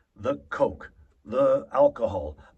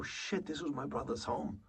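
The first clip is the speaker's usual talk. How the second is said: nearly breathed, much softer, stunned; hushed realization; 12 dB quieter than usual. Shown as a demonstration. Grieving brother taking in the empty home, hushed. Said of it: "you take a moment to go".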